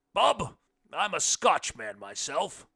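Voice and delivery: slow, loud, booming blind man; big voice of a blind guest. A narrator's reading. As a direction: boom it out big and hearty. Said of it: "he said fast enough in his big voice".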